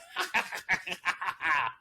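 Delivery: high mock cackle, hammed up; cackling hag laugh; witch cackle. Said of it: "a cackle to remember".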